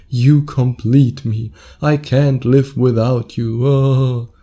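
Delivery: slow, hammed-up movie romance; mock dramatic. Podcaster mocking Hollywood movie lines, hammed-up drama. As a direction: overplay it like a Hollywood love scene. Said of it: "go all dramatic, just like the Hollywood movies".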